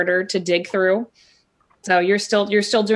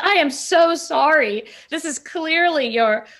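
high, slow over-sweet apology; apologetic gush; 5 semitones higher, slower than usual. Acted. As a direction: fawn apologetically, drawn out. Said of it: "she's just like".

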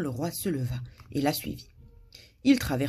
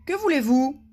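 higher, louder mournful challenge; a touch of gloom in a raised voice; lugubrious; 10 semitones higher, 6 dB louder than usual. A narrator's reading. Guard questions a visitor, lugubrious. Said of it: "d'une voix lugubre".

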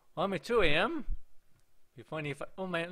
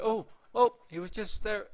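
high, loud double "oh"; startled; 8 semitones higher, 5 dB louder than usual. Real, not acted. Player interrupted by a sudden sighting in the game, startled. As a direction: cut yourself off with a jolt.

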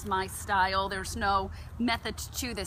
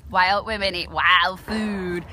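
slow, louder catchphrase delivery; slogan; 8 dB louder than usual. Acted. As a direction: proclaim it like a motto.

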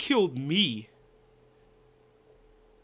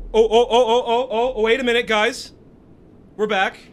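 much louder, higher rapid exclamations; excited; 4 semitones higher, 8 dB louder than usual. Real, not acted. Player telling viewers the game is back, excitedly loud. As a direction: burst out to viewers.

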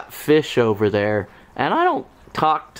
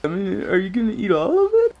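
voicing the dog; 7 semitones higher than usual. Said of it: "He says".

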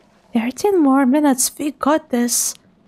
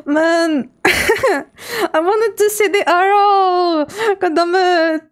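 higher, drawn-out whine through laughter; laughing complaint; 5 semitones higher, much slower than usual. Real, not acted. Player who wanted to see the arrow, laughing complaint. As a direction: keep cracking up while grumbling. Still laughing after the scare.

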